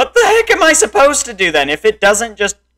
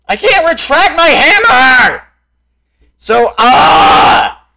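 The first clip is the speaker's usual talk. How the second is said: frustrated yell; 4 semitones higher, 4 dB louder, slower than usual. Real, not acted. Raging at game.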